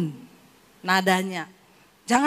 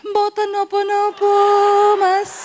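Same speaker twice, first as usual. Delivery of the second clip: higher, louder honeyed voice; sweet Javanese wife; 7 semitones higher, 6 dB louder, slower than usual. Pretend. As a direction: play the refined Solo wife. Refined wife role.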